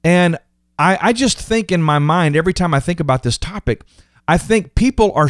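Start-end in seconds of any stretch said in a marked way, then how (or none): none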